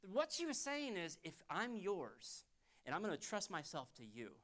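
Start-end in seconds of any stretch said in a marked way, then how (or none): 1.2-4.3 quiet, intimate appeal, fading softer; tender plea. Acted. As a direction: plead gently with the one you love. Voices wife's heartfelt plea.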